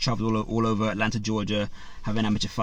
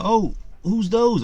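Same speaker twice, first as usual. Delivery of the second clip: much higher, slow, put-on curious voice; nosy neighbour; 10 semitones higher, much slower than usual. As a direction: play a visiting neighbour spotting the trophies. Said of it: "people in the community will come around and be like".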